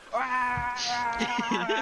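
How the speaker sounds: long, high held madman's scream; acted high-pitched scream; mad scream. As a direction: let out a long, raving "aah". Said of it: "screaming in".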